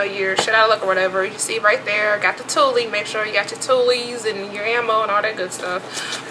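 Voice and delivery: usual speaking voice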